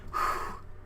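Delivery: long exhausted breath out; tired sigh. Real, not acted. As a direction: sigh of relief after the rush. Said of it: "I need a breather".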